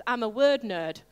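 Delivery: ordinary talk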